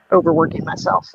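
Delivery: ordinary talk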